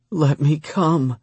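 high, slow plea; begging. A narrator's reading. Lover asking his permission, begging. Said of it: "she begged".

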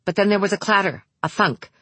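usual speaking voice

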